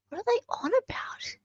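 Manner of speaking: whisper. Real, not acted. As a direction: hush it to yourself, confused. Puzzled aside while reading.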